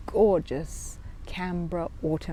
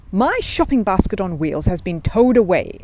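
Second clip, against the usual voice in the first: louder, indignant bear voice; hot exclamation; 7 dB louder than usual. A narrator's reading. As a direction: exclaim it hotly, upset. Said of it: "exclaimed Paddington hotly".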